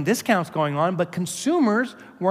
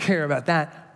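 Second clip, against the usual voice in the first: usual speaking voice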